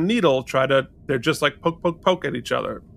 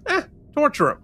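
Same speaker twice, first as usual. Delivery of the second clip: lightly acted dismissive order, higher pitched; offhand dismissal; 12 semitones higher, faster than usual. Tain shrugging off Garak's doubts about interrogating Odo, mock offhand. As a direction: toss it off as a casual order.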